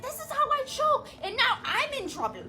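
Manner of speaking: high mock voice of the teacher; lightly imitated teacher. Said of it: "She's like".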